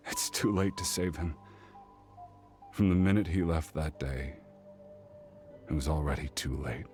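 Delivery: low, sighing sorrow; sigh, then let it sink into grief; grieving resignation. Acted. Mourning a friend beyond saving.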